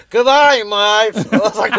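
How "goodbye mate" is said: loud, high mock British-abroad accent; hammed-up fake accent, belted out high; mock bad accent. Comic mocks friends' fake accents from abroad, mock bad accent. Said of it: "why do you have an accent".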